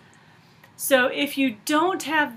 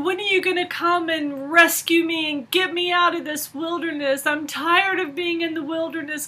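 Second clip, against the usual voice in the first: higher, louder moaning plea to God; whiny complaining; 7 semitones higher, 4 dB louder than usual. Retold or imitated. Retelling how she griped to God in despair, whiny and pleading. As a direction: play back your old self griping, woe is me. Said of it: "moaning and griping and complaining".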